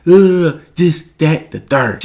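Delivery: high, scolding mama voice. Retold or imitated. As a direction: imitate a mother cussing out her son.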